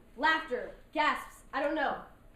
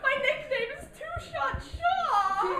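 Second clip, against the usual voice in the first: much higher, louder laughing yell, slowing at the end; panicked shout; 14 semitones higher, 10 dB louder, slower than usual. Retold or imitated. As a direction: cry out in laughing panic about driving home. Panicked retort to Tony, laughing.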